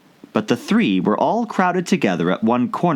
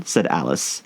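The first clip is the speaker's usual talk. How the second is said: usual speaking voice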